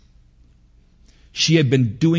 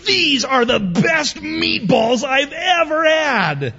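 put-on delighted little boy; slower than usual. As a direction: savour every word like a kid in meatball heaven. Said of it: "And Benny goes".

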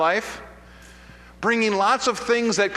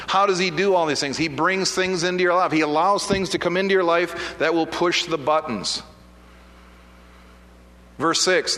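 usual speaking voice

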